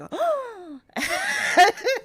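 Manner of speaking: long, high mock gasp; shocked gasp. Everybody hearing she must go to Baba Yaga, exaggerated shocked gasp. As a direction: suck in air in exaggerated horror. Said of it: "everyone's shocked".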